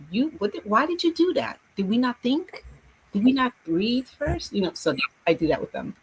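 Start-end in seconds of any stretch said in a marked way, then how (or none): 0.1-4.5 higher, firm scolding of a pupil; teacher pressing a careless pupil; stern teacher. Retold or imitated. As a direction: question the kid sharply, like a strict teacher.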